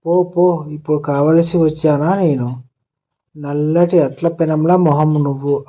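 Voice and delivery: usual speaking voice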